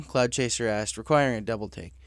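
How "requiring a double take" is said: usual speaking voice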